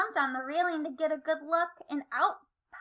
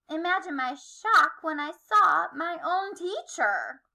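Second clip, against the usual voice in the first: usual speaking voice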